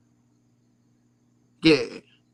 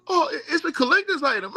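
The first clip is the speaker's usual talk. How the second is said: mock fan excuse; 12 semitones higher than usual. Fan's defensive reply voiced.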